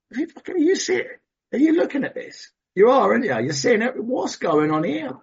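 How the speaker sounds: high-pitched, exasperated questioning; worked-up, pitch leaping upward; incredulous appeal. Retold or imitated. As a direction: demand answers from God in disbelief. Acted incredulous appeal to God.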